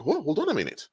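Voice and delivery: high-pitched, puzzled double take; acted dawning suspicion; sudden doubt. Acts out a sudden realisation.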